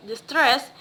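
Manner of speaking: ordinary talk, nothing marked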